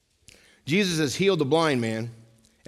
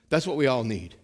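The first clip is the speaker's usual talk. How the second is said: usual speaking voice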